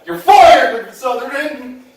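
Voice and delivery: high loud hammed-up boss, laughing through it; mock boss firing. Storyteller voicing the boss firing him, hammed-up and laughing. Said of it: "He goes".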